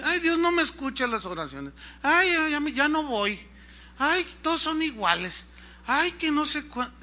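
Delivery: high-pitched imitated moaning; mock whining. Preacher mimicking impatient Christians' complaints, mock whining. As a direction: mimic a grumbler full of self-pity.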